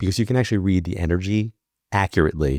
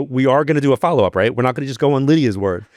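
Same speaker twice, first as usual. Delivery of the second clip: urgent insistence; 7 semitones higher, 4 dB louder than usual. Retold or imitated. Insisting to mom on follow-up.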